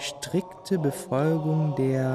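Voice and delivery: drawn-out, word by word; slow careful reading. Acted. Boy puzzling over dictionary definition, reading slowly.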